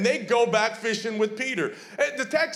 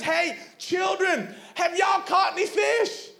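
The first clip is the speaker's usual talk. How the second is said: yelling; 11 semitones higher, slower than usual. Retold or imitated. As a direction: shout out to the fishermen from the shore. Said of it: "he yells at them".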